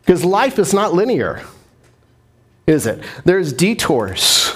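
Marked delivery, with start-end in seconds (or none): none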